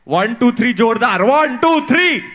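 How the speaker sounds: loud, rising count; count-in shout. Real, not acted. Actor cueing the fans to cheer, loud count-in. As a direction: count the crowd in.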